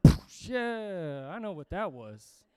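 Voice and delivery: action hero shrugging off an explosion; super cool. Acted. Preacher plays movie hero walking from explosions, mock cool. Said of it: "be super cool".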